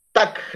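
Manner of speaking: Tac with a popped final k; syllable tac alone. Shown as a demonstration. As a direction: stop the word at "Tac". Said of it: "Tac se lee hasta ahí". (Tac, sílaba cortada en la k)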